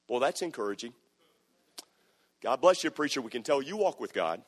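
sarcastic. Retold or imitated. Sarcastic reply to preacher.

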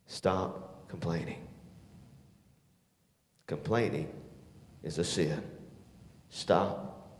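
quiet intense appeal. Acted. Preacher challenging the youth to stop complaining, soft and low. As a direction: bring it down to a hush.

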